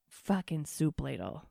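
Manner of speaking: ordinary delivery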